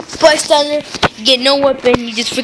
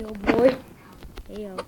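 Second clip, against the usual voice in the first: ordinary talk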